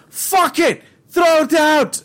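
high, loud mock rejection; mock shout. Co-host mimics bosses rejecting the first creation, mock shouting. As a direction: yell it as the dismissive crowd. Voicing dismissive crowd, shouted.

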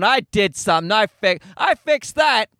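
preen like you fixed the world with a tweet; imitating a smug online activist. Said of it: "smugly folding their arms going".

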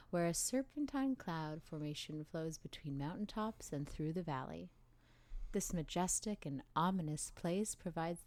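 soft, measured documentary read; calm narration. Acted. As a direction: narrate gently like a travel film. Documentary-style narration intro.

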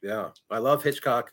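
ordinary talk, nothing marked

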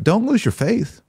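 ordinary talk

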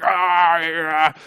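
drawn-out frustrated "aargh"; mock furious growl. Someone mad at being wronged, mock growl of fury. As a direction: let out a contained, imitated growl of anger. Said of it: "you're just furious".

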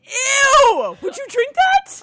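very high, loud revulsion; disgusted shriek. Real, not acted. Host recoils at pumpkin drink idea, disgusted shriek. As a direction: shriek it slowly, grossed out.

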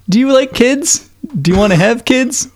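put-on Judy asking Ralph to be her dad, in a high girly voice; little girl voice. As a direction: ask it like a hopeful small girl. Co-host continues Judy.

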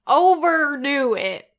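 hammed-up American accent, loud and slow; drawn-out fake American R's; overdone American accent. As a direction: lean hard on every American R. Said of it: "British actors do when they are doing an American accent".

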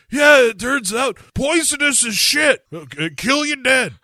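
put-on high, strained old man voice; groggy old man voice. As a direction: croak it out like a just-woken old man. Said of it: "Henry Fonda wakes up enough to be like".

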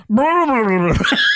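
high muffled scuba gibberish; imitated garbled yelling. Co-host imitates both divers yelling underwater, garbled. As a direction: blurt wordless noise as if through a mouthpiece. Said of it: "they just both go".

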